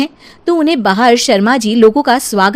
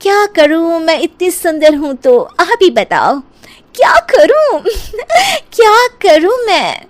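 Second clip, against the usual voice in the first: vain bride; 7 semitones higher than usual. Acted. Dimple repeats vain catchphrase.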